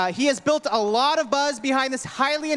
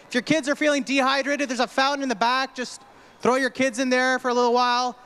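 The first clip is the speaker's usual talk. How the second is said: usual speaking voice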